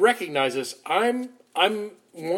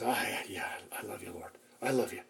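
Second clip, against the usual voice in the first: much softer, lower, ashamed reply; humble soft answer; 8 semitones lower, 13 dB quieter, faster than usual. Retold or imitated. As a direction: murmur it with your head bowed. Peter answers Jesus humbly.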